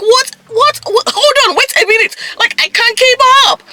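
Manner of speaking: high-pitched, bewildered outburst; overwhelmed disbelief. Retold or imitated. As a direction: squeal it like you can't keep up. Acting out her overwhelmed reaction.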